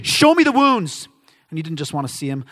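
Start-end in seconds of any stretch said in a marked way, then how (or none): 0.0-1.1 louder, higher, drawn-out demand; doubter's emphatic insistence; insistent demand. Acted. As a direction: demand proof loudly, stretching the words. Acting out doubting Thomas's demand.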